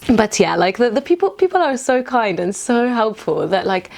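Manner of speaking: ordinary talk, nothing marked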